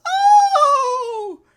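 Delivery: high, loud frightened cry; child's terrified scream. Acted. Daughter waking from the rhyme in terror, loud high scream. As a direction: scream like a startled little girl.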